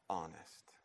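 normal talking voice

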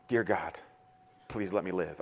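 low, hushed mock prayer for survival; quiet frightened plea. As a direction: imitate a scared boy praying under his breath. Terrified son voiced on the ride.

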